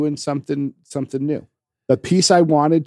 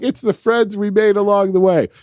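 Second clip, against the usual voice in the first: higher, louder mock-sappy voice; mock sentimental voice; 7 semitones higher, 4 dB louder than usual. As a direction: sell the cheesy moral. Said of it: "I was waiting for it to be like".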